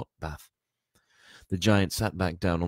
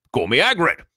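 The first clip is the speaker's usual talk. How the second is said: big, loud, raised giant's voice; hearty giant; 10 semitones higher, 11 dB louder than usual. A narrator's reading. Giant introduces himself to the boy, hearty and loud. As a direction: announce yourself heartily at full volume.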